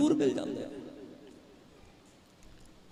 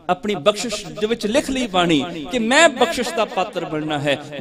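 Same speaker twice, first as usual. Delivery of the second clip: ordinary talk, nothing marked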